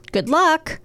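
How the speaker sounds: usual speaking voice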